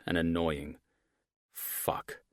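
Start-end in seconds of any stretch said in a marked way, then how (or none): 1.5-2.2 hushed whispered swear; whispered curse. A narrator's reading. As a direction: breathe the curse silently. Inner curse as dog charges.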